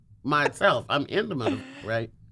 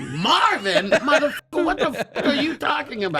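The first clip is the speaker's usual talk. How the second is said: high, loud disbelieving yell; mock outrage; 12 semitones higher, 3 dB louder than usual. Real, not acted. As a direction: shout in mock disbelief, laughing.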